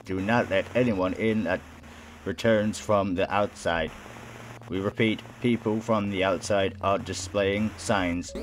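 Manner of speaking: monotone public service warning; robot reading out an announcement; robotic announcer. Acted. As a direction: deliver it evenly like an automated broadcast. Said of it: "The voice is robotic".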